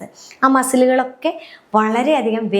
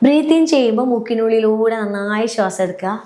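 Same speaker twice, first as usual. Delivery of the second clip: usual speaking voice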